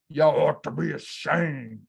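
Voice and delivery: a hint of a low scolding devil; lightly voiced devil shaming the crowd; low, mock-devilish scold. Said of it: "I'll be the devil for a minute".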